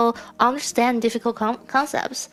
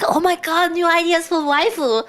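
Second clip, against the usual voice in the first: higher-pitched imitation of excited friends; mock excitement; 7 semitones higher, 4 dB louder than usual. Imitating group members sharing every update, mock-excited. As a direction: gush loosely like a hyped-up group member.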